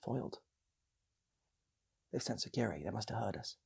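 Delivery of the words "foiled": ordinary delivery, nothing marked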